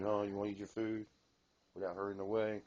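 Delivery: ordinary delivery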